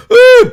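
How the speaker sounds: very high, loud mock "ooh"; imitated wife's gasp. Streamer mimics a wife seeing a brick hit, high mock gasp. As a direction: squeal it like a shocked onlooker. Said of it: "watch your wife go".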